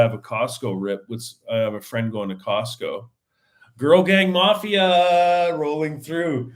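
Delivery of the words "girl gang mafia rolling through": loud, high, drawn-out announcement; playful crew call. Pretend. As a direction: holler it slowly like a hype call. Announcing the friend group playfully.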